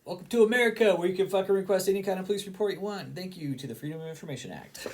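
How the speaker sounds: loud, high mock announcer cheer; sarcastic cheer. Real, not acted. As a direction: shout it like a sarcastic welcome sign. Mock cheer over police records.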